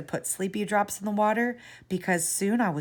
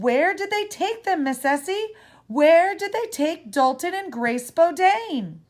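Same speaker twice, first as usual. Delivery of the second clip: urgent pleading; 8 semitones higher, 4 dB louder than usual. A narrator's reading. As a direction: beg her to say where. Child presses urgently for parents.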